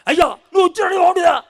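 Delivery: high, loud reproachful cry; acted shout. Martha shouting at Jesus for coming late, a loud reproachful cry. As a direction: hurl it at him like a scolding sister. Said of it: "అని అరిచింది".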